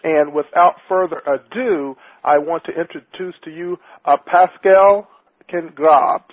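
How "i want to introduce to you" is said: usual speaking voice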